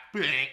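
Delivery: mock hang-up bing. Imitated hang-up sound.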